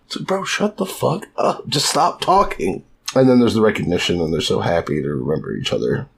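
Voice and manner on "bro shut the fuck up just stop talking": slow, higher, strained plea to shut up; exasperated. Pretend. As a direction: beg him to stop talking, fed up. Exasperated at Luffy.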